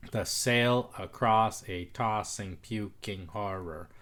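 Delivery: slow reading. Shown as a demonstration.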